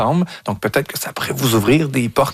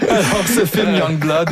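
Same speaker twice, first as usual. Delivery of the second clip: higher, slow, recovering from laughter; still laughing; 8 semitones higher, slower than usual. Real, not acted. Host returning to the film Young Blood, laughing. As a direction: pick up the thread through leftover laughter.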